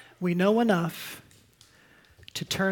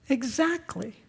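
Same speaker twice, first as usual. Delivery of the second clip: usual speaking voice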